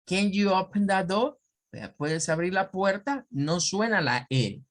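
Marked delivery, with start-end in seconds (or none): 0.0-1.4 higher British accent, rising, door without R; British accent question. Shown as a demonstration. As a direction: ask it like a Brit. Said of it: "escuchar a un británico".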